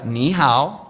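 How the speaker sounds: "Ni hao" with Mandarin tones, "ni" dipping then rising; "Ni hao" shown carefully in Mandarin tones. Shown as a demonstration. Speaker leading the audience in saying "Ni hao", with Mandarin tones. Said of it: "let's put that together".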